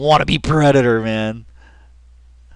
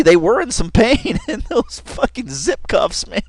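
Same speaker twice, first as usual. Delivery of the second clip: laughing; 9 semitones higher than usual. Real, not acted. Laughing about the painful cuffs.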